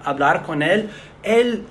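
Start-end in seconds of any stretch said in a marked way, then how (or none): none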